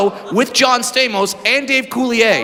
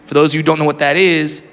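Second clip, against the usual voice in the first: normal talking voice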